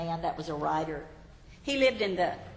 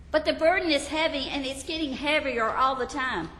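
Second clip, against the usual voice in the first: anxious confessor; 6 semitones higher, 4 dB louder than usual. Acted. Believer pleads.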